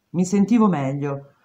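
ordinary delivery

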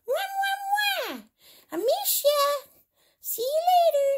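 very high bear voice blowing kisses; little bear voice. Acted. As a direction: play the teddy sending love to Hannah. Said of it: "Bear goes".

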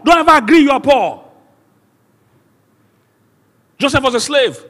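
high, loud sermon shouting; full-force preaching. Real, not acted. Preacher telling believers they are not poor, full-force preaching. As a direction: cry it out to rouse the whole congregation.

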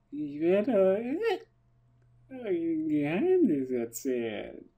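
put-on slow, high, drawn-out drunk whisper-secret; town drunk. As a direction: drag it out like a sloshed storyteller. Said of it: "muss ich jetzt betrunken reden".

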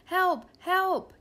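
high, loud computer alarm; mechanical voice. A narrator's reading. Kidnapped computer calls for help, mechanical voice. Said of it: "came the mechanical voice".

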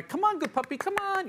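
very high, sing-song coaxing call; happy puppy voice. Shown as a demonstration. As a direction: coax the dog in like it's a party. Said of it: "You wanna".

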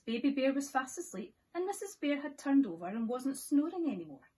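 usual speaking voice

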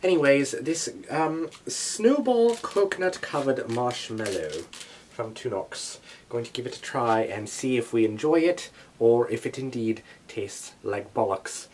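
badly faked British accent; terrible British accent. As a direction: finish it in a hammy British accent. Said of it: "in my terrible British accent".

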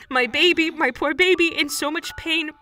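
anguished cry. Acted. Mother finds her mutilated son.

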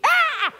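put-on a child stepped on in the dark; child's yelp. Kid yelping as dad steps on her.

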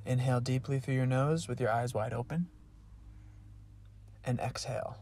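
quiet, calm breathing guide; guided breathing. Acted. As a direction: lead them softly through each breath. Leads breathing exercise.

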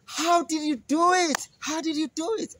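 breathless curiosity; sister goat voice. Acted. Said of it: "Sister Billy Goat said".

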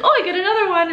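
put-on high, bright kid's glee at the reward; excited toddler voice. Mom imitating her daughter getting another egg, excited and high. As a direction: squeal it like a delighted little girl. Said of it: "be like".

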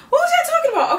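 high squeaky laughing demand; laughing disbelief. Acted. Fan mocking critics with a high laugh. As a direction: crack up while calling them out. Repeated, laughing, much higher.

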